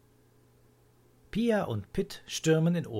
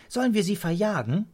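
high, loud, keen question; excited childlike proposal; eager child; 7 semitones higher, 6 dB louder, faster than usual. A narrator's reading. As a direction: offer it like a kid ready for action. Child eager to chase spirits.

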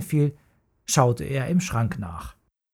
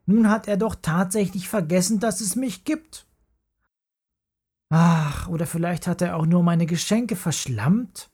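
put-on higher, fast, indignant boy's voice; upset boy; 5 semitones higher than usual. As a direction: sound wronged and baffled. Paule upset about empty boots.

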